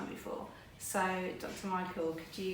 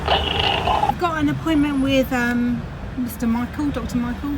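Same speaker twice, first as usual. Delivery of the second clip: loud; 4 semitones higher, 11 dB louder than usual. Real, not acted. Speaking into street intercom.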